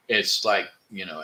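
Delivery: ordinary talk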